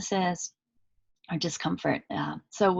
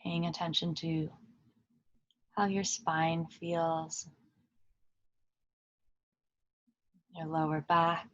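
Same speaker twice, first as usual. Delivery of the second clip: calm meditation guide with long pauses between phrases; unhurried, even voice settling listeners into stillness; guided meditation; 4 dB quieter than usual. Acted. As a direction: lead them gently inward, leaving long silences.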